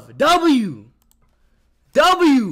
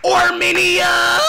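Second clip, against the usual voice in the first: long, drawn-out, higher yell; hype shout; 8 semitones higher, 4 dB louder, much slower than usual. Real, not acted. Streamer hyping a fan's country, slow excited yell. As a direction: stretch it out like a stadium chant.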